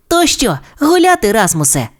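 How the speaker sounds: high-pitched eager little dinosaur; calling out. Acted. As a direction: call your friend out to play in a high voice. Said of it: "і гукнув".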